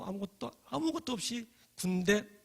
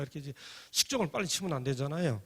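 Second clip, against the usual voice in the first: ordinary talk, nothing marked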